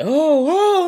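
high, loud melodramatic gasp; mock swooning. Mocking the hysterical woman, hammed-up swoon. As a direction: swoon like an old Hollywood starlet. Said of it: "dramatically falling out like an old Hollywood movie".

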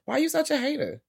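high disbelieving whine; high exasperated squeal. Retold or imitated. Her exasperated reaction.

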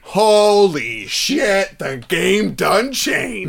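drawn-out amazed gasp; slow awed exclamation. Real, not acted. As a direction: stretch it out in awe.

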